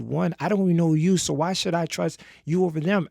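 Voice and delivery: heated retold argument, pushed up in pitch; retold arguing back. Girl arguing back at the men.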